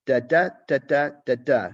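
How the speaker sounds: "Da, da, da" tapped out as a loud, even iambic beat. Shown as a demonstration. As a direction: chant the "da" syllables to show the meter. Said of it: "this kind of iambic, uh, meter".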